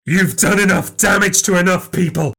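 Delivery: steel yourself to strike; menacing resolve. Acted. Resolving to kill her.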